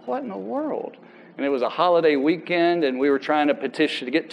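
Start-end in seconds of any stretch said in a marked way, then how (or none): 0.1-1.0 much higher, hushed disbelief. Retold or imitated. Son hearing the shooter is freed, stunned disbelief. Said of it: "And I'm like".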